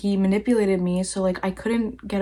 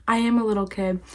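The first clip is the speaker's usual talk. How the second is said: normal talking voice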